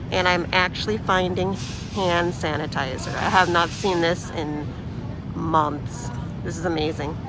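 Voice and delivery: muffled behind a mask; talking through a mask; through a face mask. Real, not acted. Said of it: "I'm wearing a mask as I'm talking".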